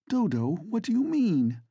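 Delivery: high, horrified question; horrified cry. A narrator's reading. Vivi hearing Dodo will join the revolution, horrified cry. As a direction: recoil in alarm. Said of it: "cries her friend in a horrified voice".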